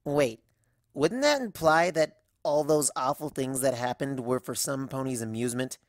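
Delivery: ordinary delivery, nothing marked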